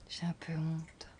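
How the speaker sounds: a slow, shy whisper; almost too ashamed to say it aloud. Acted. Aveu de honte chuchoté. (chuchotement timide et lent)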